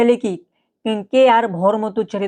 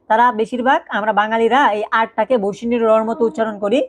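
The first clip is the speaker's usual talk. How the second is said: normal talking voice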